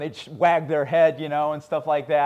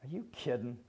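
quiet wry reply; 4 semitones lower, 14 dB quieter than usual. Retold or imitated. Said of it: "Paul goes".

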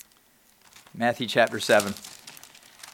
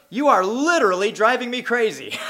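higher-pitched, exaggerated mimicry of the boys; imitating his boys; 7 semitones higher than usual. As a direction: mimic the boys' way of saying it, pitched up. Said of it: "That's how they say it".

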